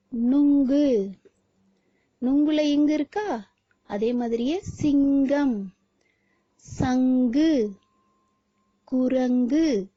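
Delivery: slow, careful word-by-word naming; slow sounding out. Shown as a demonstration. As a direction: pronounce each picture word slowly for the class. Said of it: "இத நாம ஒவ்வொன்றையும் உச்சரிக்கலாமா". (ஒவ்வொரு சொல்லையும் மெதுவாக உச்சரித்துக் காட்டு)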